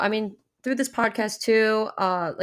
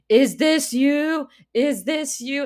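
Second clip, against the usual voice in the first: mock suspicious asking; 7 semitones higher, 5 dB louder, slower than usual. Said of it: "and I was like".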